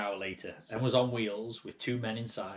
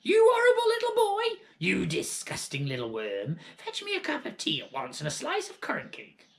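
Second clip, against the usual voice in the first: nasty grandma ranting for tea; grandma yelling; 13 semitones higher, 5 dB louder than usual. Acted. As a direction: scream insults at the boy. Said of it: "Grandma yelled".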